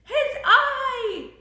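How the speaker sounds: high, loud pointing shout; high storybook shout. A narrator's reading. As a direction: shout it as startled George. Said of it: "He pointed and shouted".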